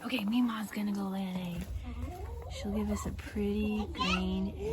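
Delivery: hushed aside about the hen; whispering. Real, not acted. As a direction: whisper so the hen isn't disturbed.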